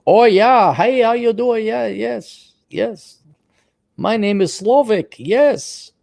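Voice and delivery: high, eager put-on foreigner voice; mock foreign guy. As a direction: play the awkward new arrival abroad. Caller mimics foreign farmer in bar.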